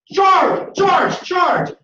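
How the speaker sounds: very high, very loud charge yell; battle cry. Retold or imitated. Colonel on the wall leads his men in a charge, yelling. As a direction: scream it from the top of the wall.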